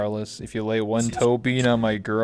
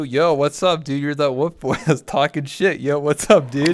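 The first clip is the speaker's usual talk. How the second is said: loud, higher bro greeting; hyped interviewer; 4 semitones higher, 5 dB louder than usual. Pretend. A host loudly greeting the wolf boy guest. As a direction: pump it up like a hype host.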